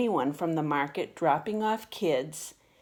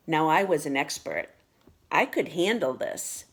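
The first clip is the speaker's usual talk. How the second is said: ordinary delivery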